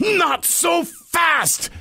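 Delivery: high, drawn-out pirate objection; pirate refusal. Acted. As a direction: stretch it out to halt the parrot. Pete stops the parrot.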